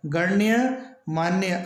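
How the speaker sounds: गण्य मान्य said slowly, half ण clearly joined to य; slow correct pronunciation. Shown as a demonstration. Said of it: "इसका शुद्ध रूप होता है". (गण्य मान्य धीरे-धीरे, आधा ण साफ़)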